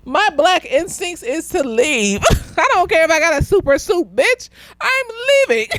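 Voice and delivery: high-pitched words breaking into laughter; laughing declaration. Real, not acted. Fan joking she would flee despite powers, laughing hard. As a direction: insist on it while cracking up.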